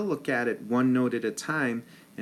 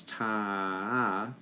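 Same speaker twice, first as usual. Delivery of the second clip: held-out note. Shown as a demonstration. Teacher demonstrating a held note, long and slow. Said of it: "it's a held out note".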